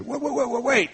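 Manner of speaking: high, urgent calls of wait; calling after him. Retold or imitated. Vincent calls after the departing Dyer, urgent high calls. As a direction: call him back before he's gone.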